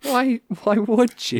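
high, slow disbelief; why would you, stunned; incredulous. Real, not acted. Disbelief at friend's search.

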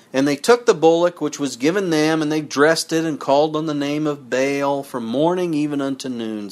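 measured sermon reading with a drop at the end; scripture reading. Acted. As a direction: read it out like a preacher. Reads First Kings aloud.

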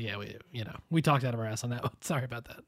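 half-hearted British apology, dropped low and quiet; bad British accent. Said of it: "Your British accent is not great today".

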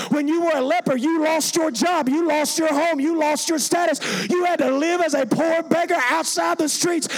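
forceful preacher's voice; impassioned preaching. Real, not acted. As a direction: hammer each loss home to the congregation.

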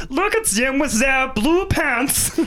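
put-on high, slow comic German accent; mock Hitler in a shrieky, stretched German accent. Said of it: "that's a German accent".

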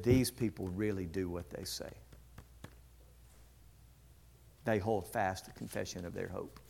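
low, soft, solemn testimony; quiet sincerity. Retold or imitated. Student minister to preacher about these people, quiet sincerity. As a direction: vouch for them quietly and earnestly.

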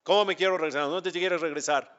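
mildly acted disbelief; incredulous echo. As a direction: throw the words back as a startled question. Father echoes the soul's plea incredulously.

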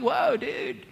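high-pitched Southern California surfer-style exclamation; Southern California dude. Acted. Preacher imagining Isaiah from Southern California, SoCal dude accent. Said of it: "If he was from Southern California".

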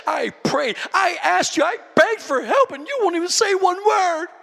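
high-pitched, hammed-up grievance; offended complaint. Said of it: "been offended, upset".

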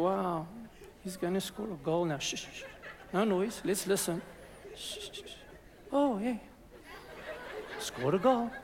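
soft, laughing mock hush at a match; hushed prayer voice at sport. Mocking a fan who cheers like a quiet prayer, hushed. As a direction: whisper at a football match as if in church. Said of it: "take your prayer voice to sport".

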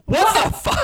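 high-pitched mock outrage; losing it. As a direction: imitate someone freaking out in disbelief. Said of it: "like, losing it".

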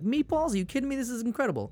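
high-pitched amazed reaction; delighted disbelief. Retold or imitated. Telling co-host about neighbor's gift of meatballs, delighted disbelief.

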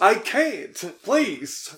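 high, loud mock pleading; mock begging. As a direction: beg the mob boss for mercy. Playing underling begging mob boss.

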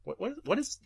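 ordinary delivery

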